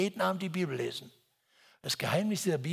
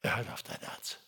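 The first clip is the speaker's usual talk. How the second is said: hushed refrain; 6 semitones lower, 6 dB quieter than usual. Real, not acted.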